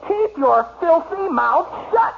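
high, slow, furious command; enraged. Acted. Husband silences taunting wife, enraged. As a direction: explode at her, dragging out each word.

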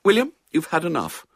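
brisk mother's telling-off; scolding mother. A narrator's reading. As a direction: stop the boy eating, briskly. Mother scolds greedy son at tea.

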